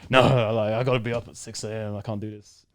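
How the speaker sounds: bad imitation of a deep-voiced coach; lightly put-on deep, aggressive coach voice; Coach Mason. Coach too tired to deal with a stranger, badly faked deep voice. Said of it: "that's a bad imitation. He, he has a real deep voice".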